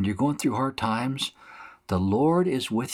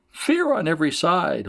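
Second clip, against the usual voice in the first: high, taunting singsong echo; mocking; 8 semitones higher than usual. Mockers taunting Jeremiah with his prophecy, mocking. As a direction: throw his words back in a high jeer. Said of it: "they're mocking him, saying".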